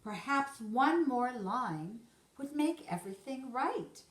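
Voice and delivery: plain reading voice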